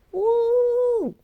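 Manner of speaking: loud high whoop of awe; high excited woo. Real, not acted. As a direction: let out an awed whoop. Exclaiming about the celestial realm.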